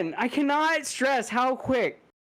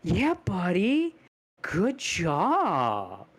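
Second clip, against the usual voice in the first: slow, offhand mock praise; imitating a dad's flat-sounding praise, slowed; insincere-sounding praise; much slower than usual. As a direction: say it so it sounds sarcastic though meant. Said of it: "sounds like he's sarcastic".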